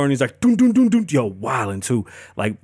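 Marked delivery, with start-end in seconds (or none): 0.4-1.1 loud high booming knock noises; knocking on the door. Retold or imitated. Mimicking Dog pounding on a suspect's door, loud high knocks. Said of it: "He goes knocking on the door".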